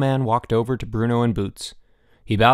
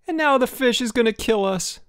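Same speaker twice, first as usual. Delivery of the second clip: very high-pitched panicky yell; screaming; 13 semitones higher than usual. A narrator's reading. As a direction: shriek it high in comic terror. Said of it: "screamed Boots".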